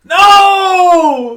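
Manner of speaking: high, loud, long-held cry of protest; outraged shout. Real, not acted. Friend hearing his pick was taken, outraged shout. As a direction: wail it in playful outrage.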